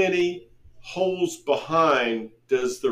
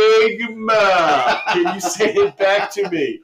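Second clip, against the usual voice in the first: disdainful correction; 3 semitones higher, 3 dB louder than usual. Retold or imitated. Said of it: "that disdainful tone".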